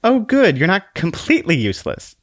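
high, prim voice with mild relief; a dry, lightly relieved sigh of approval; relieved little book. Acted. Book tells Conscience, high and mildly relieved. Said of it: "in a tone of genuine relief".

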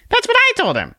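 very high, tiny squeaky voice; a tiny exasperated sprite scolding; squeaky little Conscience. Acted. Conscience snaps at the man in a very high squeak.

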